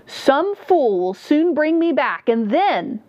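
high, slow, drawn-out cry. A narrator's reading. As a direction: cry it out slowly as the river drags you under. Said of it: "he cried out".

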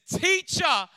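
"Teacher" cried out high and loud; "Teacher" as a loud, high joyful cry; joyful exclamation. Retold or imitated. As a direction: burst out with "Teacher" in recognition. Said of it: "and exclaims".

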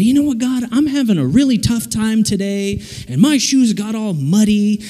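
high whine climbing to a thin squeal; mock whining meltdown. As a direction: complain to God, rising into comic hysteria. Acted complaining prayer, building to breakdown.

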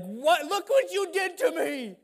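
high, loud, outraged; gray-haired parent venting at the kids; exasperated parent. Retold or imitated. Worn-out parents blaming their kids, loud high exasperated. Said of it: "parents with gray hair are like".

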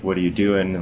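ordinary talk, nothing marked

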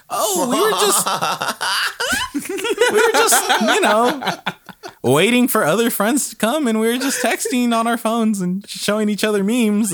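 high, over-bright hammed-up cover story; stall, then rattle off an alibi high; nervous excuse. Students lying to suspicious teacher, nervous excuse.

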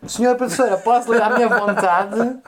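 mais agudo e mais lento, vozinha de aluno aplicado a brincar; aluno a fingir. Pretend. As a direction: pedir licença à professora, meio a gozar. Pede à colega como a uma professora. (a brincar, pede à colega que o deixe ler, com voz de aluno)

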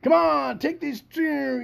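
mock the skaters with a drawn-out call; mock skater plea. Host mocking falling Olympic skaters, hammed-up plea.

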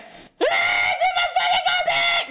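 shrieking way up high; panicked scream. Retold or imitated. Reliving finding the cockroach in her armpit, shrieked. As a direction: scream it like a roach is in your shirt. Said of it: "Like, I scream".

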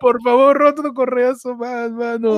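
high, hammed-up begging; playful desperate plea; mock pleading. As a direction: beg like a mock martyr. Mock begging for more punishment.

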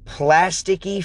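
ordinary talk

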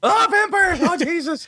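falsetto fake panic; mock panicked shriek. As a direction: squeal like a terrified player spotting vampires.

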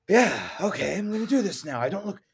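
fast, strained, trying to seem normal; Batman villain voice. Retold or imitated. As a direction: fake calm from an unhinged villain. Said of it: "Still a Batman villain at this point".

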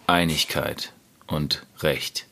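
sehr langsam und tief, Silbe für Silbe; ganz langsam. Retold or imitated. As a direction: getragen langsam wie eine feierliche Hymne. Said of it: "so ganz langsam sagen". (ganz langsam gesprochen)

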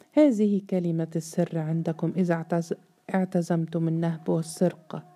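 ordinary delivery

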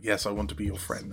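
quiet mock eager fan. A stranger who has never met him, murmured eager reply. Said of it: "and they're like".

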